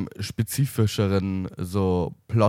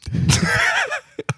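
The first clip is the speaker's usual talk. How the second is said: loud high squeal of laughter; breathy laughing shriek; 3 dB louder than usual. Real, not acted. Laughs after a funny moment.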